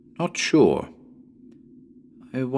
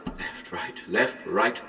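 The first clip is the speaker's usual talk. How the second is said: whispered left, right; unvoiced eeny-meeny left, right; whispered counting; 2 semitones lower, 7 dB quieter, slower than usual. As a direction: count it off under the breath. Choosing a door.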